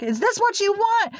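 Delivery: high-pitched mock shriek of an angry grandma. Grandma scolding her bleeding granddaughter, imitated high angry shriek. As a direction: retell grandma's furious outburst, voice jumping up high. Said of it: "She got mad".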